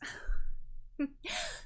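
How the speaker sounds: high, airy little laugh; character's laugh. Acted. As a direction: laugh it off as the island sister. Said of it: "She sort of laughs".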